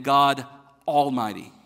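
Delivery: "God" punched loud, then "Almighty" set apart; pause, then land "Almighty" hard; stress on Almighty. Shown as a demonstration. Pastor contrasting "Mighty" with "Almighty", stress on "Almighty". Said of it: "a big difference in just God the Mighty".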